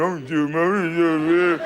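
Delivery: mock whinge, words melting together.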